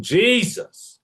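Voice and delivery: high mock scream of "Jesus!"; imitating his scream. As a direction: exclaim "Jesus!" like a theatrical uncle. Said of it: "the way he screamed".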